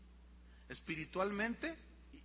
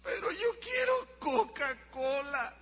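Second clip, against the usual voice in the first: put-on slow, high-pitched child's whine; whining child; 5 semitones higher, 3 dB louder, slower than usual. Child protests dad's sugar warning, slow high whine. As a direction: wail it like a child denied a soda. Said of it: "Y él".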